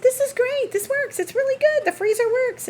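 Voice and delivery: high, delighted mock voice of the repairman; imitating Ronald. Repairman praising the new fridge, high delighted mock voice. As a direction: squeak out his happy thanks.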